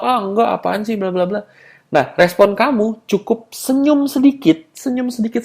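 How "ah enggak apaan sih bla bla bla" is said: high-pitched mock protest; dismissive denial. Woman rejecting the accusation that he's sexy, mock dismissive denial. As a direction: wave it off in a higher voice. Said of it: "jika dia menolak".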